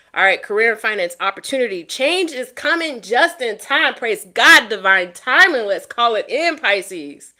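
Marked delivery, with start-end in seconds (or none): 1.8-3.9 excited announcement. Real, not acted. 3.9-5.7 loud, happy exclamation; joyful praise. Real, not acted. Loud praise over good card.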